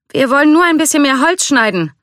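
high-pitched shouted answer; calling back. A narrator's reading. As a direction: call back over the distance, pitch way up. Calling back while walking off.

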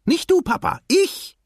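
put-on very high, loud child's voice; excited calling out. A narrator's reading. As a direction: correct Dad with an eager high shout. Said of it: "rief Nemo". (hoch und aufgeregt rufen)

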